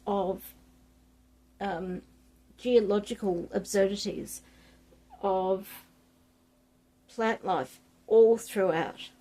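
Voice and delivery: usual speaking voice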